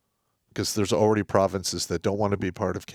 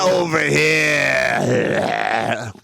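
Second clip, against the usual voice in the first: put-on slurred, slow, loud drunk Canadian accent; 7 semitones higher, 7 dB louder, much slower than usual. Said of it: "That's my drunk Canadian accent".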